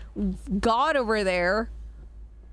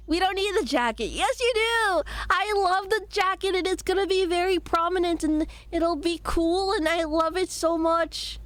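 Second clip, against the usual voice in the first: put-on high cutesy baby voice; 7 semitones higher than usual. Shown as a demonstration. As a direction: squeak it like a little kid. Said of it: "baby voice".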